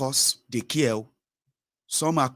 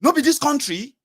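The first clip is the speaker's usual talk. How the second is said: high, loud exasperated outburst; exasperated shout; 6 dB louder than usual. Retold or imitated. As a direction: blurt it out like a fed-up businessman. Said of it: "he was turned around and said".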